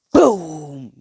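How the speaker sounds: loud "boom!"; explosion sound. Retold or imitated. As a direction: shout out the boom.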